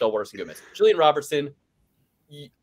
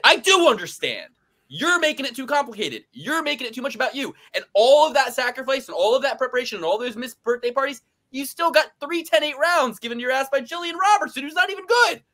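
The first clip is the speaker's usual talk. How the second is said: heated rant; 11 semitones higher than usual. Real, not acted. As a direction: yell your frustration at them.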